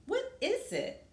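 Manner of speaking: put-on high, eager child's question; little boy voice.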